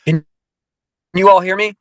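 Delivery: usual speaking voice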